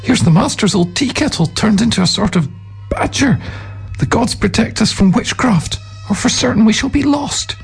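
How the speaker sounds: put-on high-pitched, panicky young monk; squeaky, frightened outcry; alarmed novices. A narrator's reading. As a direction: cry out in superstitious alarm.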